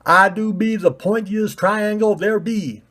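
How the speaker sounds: a proud bumpkin boast; odd country voice. Acted. Pointy ball's odd voice.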